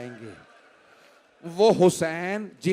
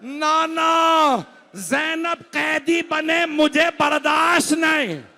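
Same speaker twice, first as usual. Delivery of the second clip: high, loud, slow anguished wail; crying out in grief; 8 semitones higher, 5 dB louder, slower than usual. A narrator's reading. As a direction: wail it like a son who cannot bear it. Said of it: "मौला रोने लगे". (रोते हुए ऊँची, धीमी पुकार)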